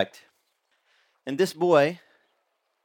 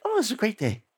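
lightly voiced cheerful boy; 6 semitones higher, faster than usual. [name] answering again.

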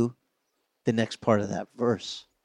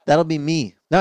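normal talking voice

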